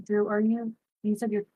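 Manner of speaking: ordinary talk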